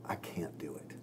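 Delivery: breathy whispered reluctance; whispered Moses. Retold or imitated. Retelling Moses refusing God, in a whisper. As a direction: shrink away and whisper it. Moses voiced in retold story.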